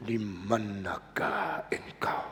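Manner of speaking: slow whispered question. Shown as a demonstration. Preacher tries the line as a slow whisper. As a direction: breathe it out slowly, barely audible.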